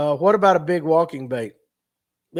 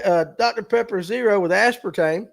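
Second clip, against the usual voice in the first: usual speaking voice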